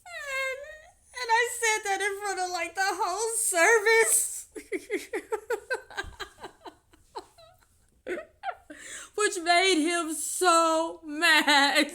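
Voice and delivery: high, loud, laughing through it; can barely talk for laughing; laughing so hard it breaks into tears; laugh-crying. Real, not acted. Laughing while retelling defiance.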